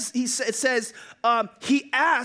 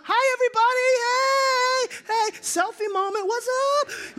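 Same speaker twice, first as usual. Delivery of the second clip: high, loud cheery hello to the crowd; excited selfie greeting; 12 semitones higher, 4 dB louder than usual. Retold or imitated. Philip greeting the crowd for a selfie, high excited shout. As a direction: wave and beam at the crowd for a selfie.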